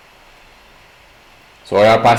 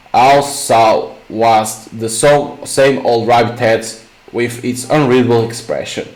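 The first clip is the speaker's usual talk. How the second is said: ordinary delivery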